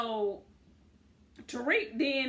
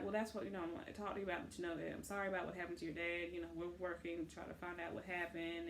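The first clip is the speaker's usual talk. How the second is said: gentle condolence; 5 semitones lower, 12 dB quieter, faster than usual. Retold or imitated. Cop consoling victim's daughter, retold.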